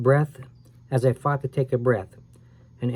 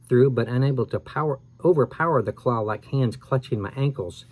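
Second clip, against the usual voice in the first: ordinary delivery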